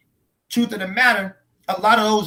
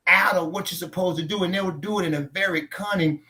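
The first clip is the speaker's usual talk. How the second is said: ordinary talk, nothing marked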